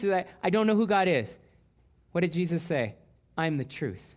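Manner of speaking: ordinary talk, nothing marked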